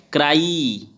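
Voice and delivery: क्राई with a long "ee" at the end; stretched ending. Shown as a demonstration. Teacher showing the final vowel, क्राई with stretched ई. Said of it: "ई आ रहा last में".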